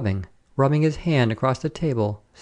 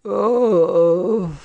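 pained groaning cry; 7 semitones higher, 4 dB louder than usual. A narrator's reading. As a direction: let out a tearful groan. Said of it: "groaned the man with the eyes".

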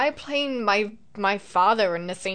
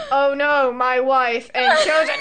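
mocking bad acting; 3 semitones higher, 6 dB louder, slower than usual. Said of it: "standing there just like".

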